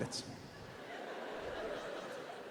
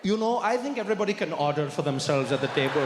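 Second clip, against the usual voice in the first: lower, measured Indian English; Indian accent; 4 semitones lower than usual. Acted. Wriggling out of repeating the order, Indian accent. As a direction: backpedal stiffly in an Indian accent. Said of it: "You got a bit of an accent".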